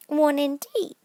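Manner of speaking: high, indignant scoff; indignantly. A narrator's reading. Dormouse indignant at Alice's doubt. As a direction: scoff at the notion. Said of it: "said the Dormouse indignantly".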